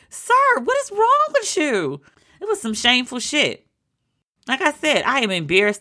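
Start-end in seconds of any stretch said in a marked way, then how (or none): 0.1-2.0 very high, shrill-pitched disbelief; shocked outrage. Retold or imitated. Yelling at Trump over the moderator, very high outraged disbelief. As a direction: gasp it at him in outrage. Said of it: "I was like".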